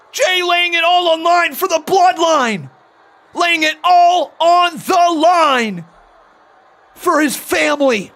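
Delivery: screaming high-energy play-by-play; wrestling commentator. Acted. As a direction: yell the big spot like ringside. Live call of the table spot.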